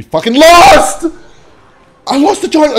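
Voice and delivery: loud high slow frustration; frustrated shout. Real, not acted. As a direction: yell out the loss. Losing the game.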